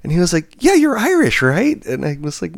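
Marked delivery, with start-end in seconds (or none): 0.6-1.8 loud, very high imitated insistence; insistent customer. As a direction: mimic an excited stranger doubling down. Retells customer insisting excitedly.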